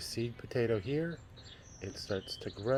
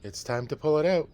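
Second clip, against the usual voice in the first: higher, louder farmer declaring it's time; farmer's eager call; 6 semitones higher, 8 dB louder than usual. Acted. Farmer deciding to harvest the potato, calling out eagerly. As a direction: announce it like a keen farmer. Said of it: "said the farmer".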